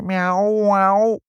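high, loud, drawn-out meows; cat meowing. A narrator's reading. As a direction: yowl like an insistent cat. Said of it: "zei Moortje". (hoog, luid kattengemiauw)

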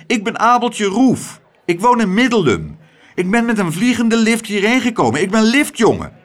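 hoge, luide jongensstem. A narrator's reading. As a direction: stel je luid en helder voor als jongen. Abeltje stelt zich voor, niet begrepen. (luid en hoog verklaren)

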